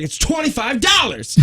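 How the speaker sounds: high mock shout; imitated angry man. Tow driver shouting his price at caller, mock angry. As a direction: bark it out, mimicking his anger. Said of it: "He was all angry".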